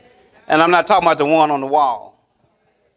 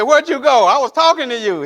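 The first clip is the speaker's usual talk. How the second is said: wife's raised voice; 9 semitones higher than usual. Retold or imitated. Imitating his wife calling from the house, raised. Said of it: "her voice goes up".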